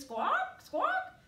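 very high, loud parrot squawks. Pretend. Teacher to the children, showing a parrot squawk. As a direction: screech it out like a parrot.

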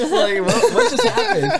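high, laughing disbelief; laughing bewilderment. Retold or imitated. Driver recalling a stick-waving walker, laughing in disbelief. Laughing at his own strange encounter.

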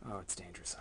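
unvoiced whisper of Oh, it's dangerous. Real, not acted. Whispering Oh, it's dangerous while handling spiders. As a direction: breathe Oh, it's dangerous tensely.

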